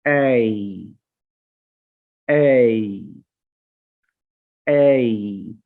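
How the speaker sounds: ऐ said slowly, gliding from ए to ई; drawn-out diphthong. Shown as a demonstration. Teacher models the diphthong ऐ for students, gliding slowly. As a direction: stretch ऐ and let it glide to ई. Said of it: "ये इसकी pronunciation है".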